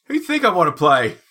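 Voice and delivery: loud, high mock British challenge; terrible British accent. Host keeps up a bad British accent, loud and high. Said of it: "my terrible British accent".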